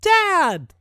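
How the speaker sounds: put-on very high-pitched child's call; small boy's yell. A narrator's reading. Smallest boy greeting his father home, yelling. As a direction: yell out like an excited little kid. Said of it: "the smallest boy yells".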